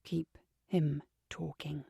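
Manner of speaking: low, hushed, spaced-out words; slow whisper. A narrator's reading. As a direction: whisper it slowly, word by word. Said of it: "whispering slowly".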